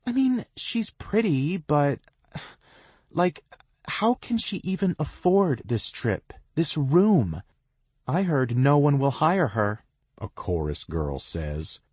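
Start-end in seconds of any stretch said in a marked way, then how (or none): 0.1-7.4 catty. A narrator's reading. Catty girl gossips. 8.1-9.9 gossipy. A narrator's reading. Chorus girl gossips.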